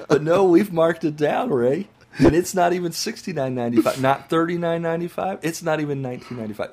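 loud, hammed-up TV sales pitch; infomercial. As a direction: count the prices down like an ad. Infomercial price countdown.

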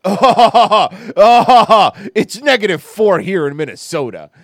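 loud, high mock-excited northerner; mock gloating Minnesotan. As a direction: ham up a smug cold-bragger. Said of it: "And they're like".

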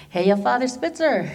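lightly voiced cartoon bear greeting; a hint of Yogi Bear, cheery hey. Greeting her boss in a mild Yogi Bear voice. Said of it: "I make it sound like Yogi Bear".

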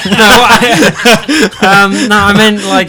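loud, high, helpless laughter in the words; laughing. Real, not acted. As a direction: try to talk while cracking up. Host cracking up after being corrected.

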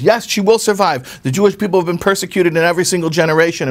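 mother answering the farmer's wife, mildly defiant. Retold or imitated. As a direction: insist on survival with conviction.

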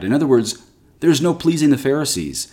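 ordinary talk

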